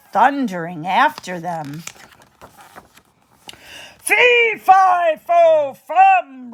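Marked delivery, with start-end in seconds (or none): 4.0-6.5 much higher, louder roar; the giant roars as he chases Jack. Acted. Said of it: "roared the giant".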